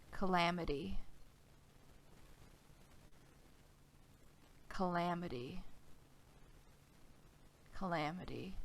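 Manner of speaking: a name called softly, fading each time; fading eerie voice. Acted. Unseen voice calling the player's name, fading away. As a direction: let the name echo away into nothing.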